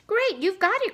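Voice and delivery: high, delighted cry; excited cry. Acted. Storekeeper thrilled by the Audubon idea, high excited cry. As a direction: jump up in pitch with delight. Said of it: "cried the storekeeper".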